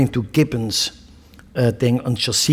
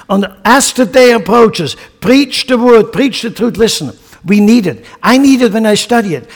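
higher, louder fiery sermon build; full-force preaching; 6 semitones higher, 4 dB louder than usual. Real, not acted. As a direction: rouse the congregation.

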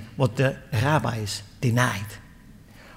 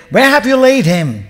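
urgent question; 9 semitones higher, 10 dB louder than usual. Acted. Jesus asks, troubled.

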